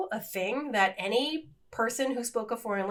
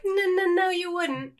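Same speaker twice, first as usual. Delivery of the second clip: high, quick-fire retold objection; retold protest; 9 semitones higher than usual. Wife retelling her answer to husband, high-pitched protest. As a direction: contradict him, voice jumping up.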